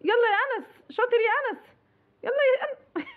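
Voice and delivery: high, bright-pitched encouragement, laughing at the end; high coaxing cheer. Real, not acted. Mother urging her son to open his mouth, high coaxing cheer.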